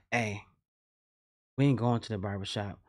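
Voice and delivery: hushed, low quoted whisper from big brother. Retold or imitated. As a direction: drop to a low secret whisper. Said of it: "Wait, why you whispering?".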